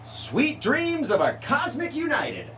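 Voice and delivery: high, slow, dramatic title reading; grand announcer. Pretend.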